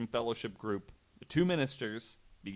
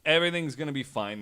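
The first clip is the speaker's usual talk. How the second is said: higher, louder, fast brush-off; mock dismissive priests; 5 semitones higher, 7 dB louder than usual. Priests brushing off the minister's wife, mock dismissive brush-off. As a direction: wave her warning away breezily. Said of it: "And they were like".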